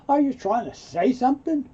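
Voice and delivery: high, loud affronted question; offended tone. Shown as a demonstration. Teacher demonstrating the tone of voice, offended. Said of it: "pay attention to the tone of voice".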